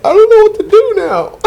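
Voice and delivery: high helpless woman's whine. Retold or imitated. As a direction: pitch up, lost and helpless. Woman's reply voiced in story.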